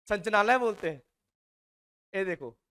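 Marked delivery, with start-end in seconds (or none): 0.1-1.0 संचनालय said clipped, dropping the ला syllable; mock lazy pronunciation. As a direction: imitate the careless short form संचनालय. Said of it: "के लोग संचालय बोलते हैं". (संचनालय को ढीले ढंग से छोटा करके बोलना)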